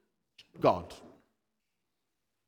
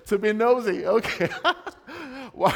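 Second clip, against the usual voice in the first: louder, higher, through laughter; laughing while speaking; 6 semitones higher, 6 dB louder than usual. Real, not acted. Preacher laughing as he repeats the answer.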